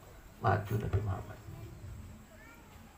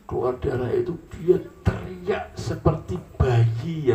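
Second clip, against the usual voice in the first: dramatic slow telling; 6 semitones higher, 5 dB louder, slower than usual. A narrator's reading. Dramatic narration of the scream.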